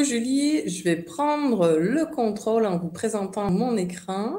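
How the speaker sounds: normal talking voice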